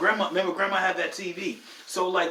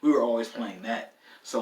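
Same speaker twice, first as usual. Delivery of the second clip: ordinary talk, nothing marked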